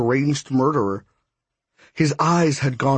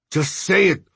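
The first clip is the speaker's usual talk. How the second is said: spat out; 6 semitones higher than usual. Acted. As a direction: spit the words out angrily. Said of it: "Hondo spat".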